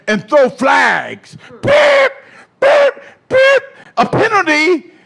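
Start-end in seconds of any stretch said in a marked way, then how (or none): 1.6-3.6 shrill high referee's whistle beeps; loud, high vocal whistle blasts; imitated whistle. Preacher mimics referees blowing whistles, high imitated beeps. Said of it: "began to blow whistles and". 4.0-4.9 lightly acted referee's call, pitched up; mock referee. Preacher plays spiritual referees calling a foul, mock referee call. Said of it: "the spiritual referees".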